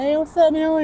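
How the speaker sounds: sing-song hello to an infant; baby talk. Retold or imitated. Uncle cooing at his new baby nephew. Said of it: "I'm like".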